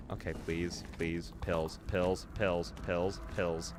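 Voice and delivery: low, soft repeated wishing; small hopeful murmur; hushed pleading. Real, not acted. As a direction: chant it quietly under your breath. Hoping to find pills.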